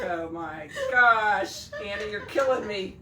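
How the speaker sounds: slow, laughing high protest; laughing groan. Retold or imitated. To Anna after the punchline, laughing groan. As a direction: groan through laughter at the pun. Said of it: "I was like".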